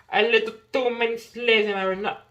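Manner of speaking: put-on hammy British-accented repeat, for fun. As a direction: toss in a playful British accent. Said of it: "I must have this British accent".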